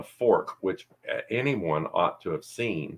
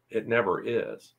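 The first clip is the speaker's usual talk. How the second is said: usual speaking voice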